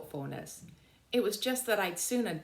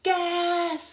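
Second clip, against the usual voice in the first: loud high alarm shout; holler; 13 semitones higher, 8 dB louder than usual. Acted. A soldier hollers to warn the trench of gas, loud. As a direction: holler the warning across the trench. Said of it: "the soldier hollered".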